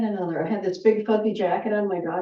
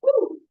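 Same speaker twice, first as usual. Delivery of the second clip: high excited woo; 13 semitones higher than usual. Real, not acted. Sudden exclamation during stretch.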